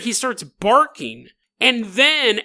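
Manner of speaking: ordinary talk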